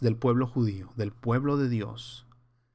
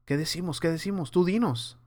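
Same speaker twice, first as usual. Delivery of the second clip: higher, hushed voice of unsure followers; lightly acted, higher-pitched asking around; clueless crowd; 5 semitones higher than usual. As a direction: play people anxiously looking to their leaders for the answer. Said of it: "esta gente volteando a ver a los religiosos".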